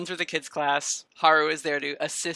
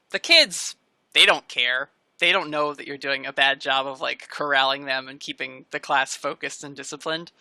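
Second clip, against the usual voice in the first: usual speaking voice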